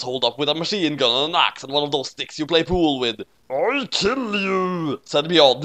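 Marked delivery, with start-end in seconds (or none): 3.5-5.0 slow, drawn-out menacing threat; ringtone skull voice. A narrator's reading. Beyond threatens L, slow menacing threat. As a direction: drag out the threat like a cartoon villain. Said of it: "talking like that ringtone dude with the skull".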